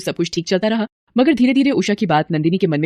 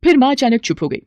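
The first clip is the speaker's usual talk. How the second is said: ordinary delivery, nothing marked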